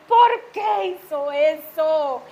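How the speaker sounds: slow, drawn-out loud shout; scolding shout, stretched out; re-enacted mother's yell. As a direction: yell it at a child, word by word. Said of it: "Cuando yo grité".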